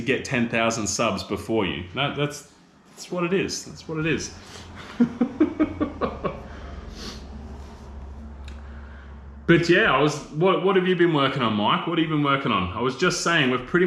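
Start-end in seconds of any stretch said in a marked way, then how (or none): 4.5-9.4 long airy wheezing laugh; breathy high laugh. Real, not acted. Laughing at his joke about beating Mike, high and airy. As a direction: let out a breathless giggle.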